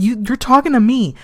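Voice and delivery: ordinary talk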